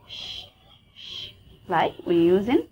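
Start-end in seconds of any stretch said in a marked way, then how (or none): 0.1-1.3 "sh" as an isolated soft hiss; phoneme demo. Shown as a demonstration. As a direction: sound out "sh" on its own. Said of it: "it's a sign for this phoneme".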